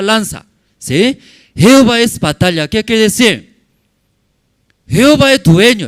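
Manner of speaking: loud, high preaching; preaching at full force. Acted. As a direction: thunder it out to the congregation. (predicación a todo pulmón)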